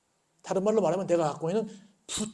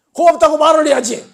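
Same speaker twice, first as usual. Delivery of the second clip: calling out; 10 semitones higher, 14 dB louder than usual. Acted. Teacher calls after fleeing robber.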